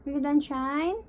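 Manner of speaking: normal talking voice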